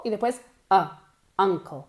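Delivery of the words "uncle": ordinary delivery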